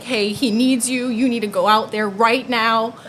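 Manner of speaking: loud, insistent retold plea; urgent plea. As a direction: push for help right now, loudly. Urging dad to help, retold.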